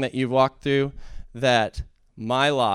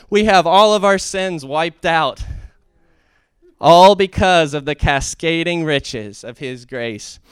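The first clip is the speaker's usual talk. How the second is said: higher and louder, swelling with conviction; preaching at full force; 6 semitones higher, 8 dB louder than usual. Acted. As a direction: preach it to the rafters. Preaching climax.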